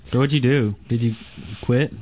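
ordinary delivery

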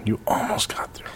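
soft, voiceless whisper. Real, not acted. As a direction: whisper it under your breath. Repeats tease in a whisper.

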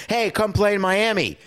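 celebrity pitching a free agent, hyped; pitchman. Retold or imitated. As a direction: sell it like a celebrity promo. Celebrity recruiting video voiced.

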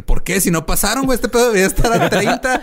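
high, outraged whine; mock indignant complaint; frat bro. Retelling own complaint about price.

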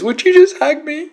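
loud, very high pleading; hammed-up emotional outburst; imitated tearful plea. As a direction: beg for comfort at full pitch. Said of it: "he starts wailing again and crying".

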